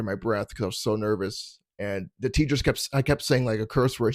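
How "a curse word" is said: ordinary delivery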